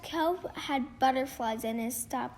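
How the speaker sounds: usual speaking voice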